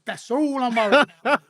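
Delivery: said through a laugh, cheeky; laughing retort. Retold or imitated. Retold cheeky reply to copper.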